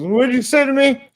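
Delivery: high-pitched imitated challenge; confrontational young man. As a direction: mimic a guy picking a fight.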